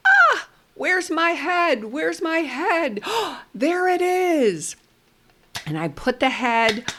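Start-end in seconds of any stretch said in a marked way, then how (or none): 0.1-4.8 high, slow, wondering play voice; playful toy play voice. Shown as a demonstration. Therapist playing with a toddler, high slow wondering voice. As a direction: puzzle aloud like a toddler's playmate. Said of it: "I play with the tools and say".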